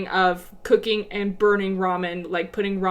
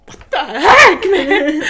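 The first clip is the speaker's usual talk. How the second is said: exasperated shout; 6 semitones higher, 11 dB louder, slower than usual. Retold or imitated. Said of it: "I'm like".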